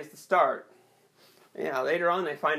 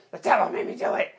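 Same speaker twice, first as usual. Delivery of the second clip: devil voice; faster than usual. Acted.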